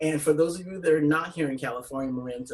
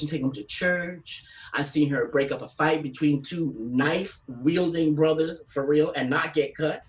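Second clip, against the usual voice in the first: usual speaking voice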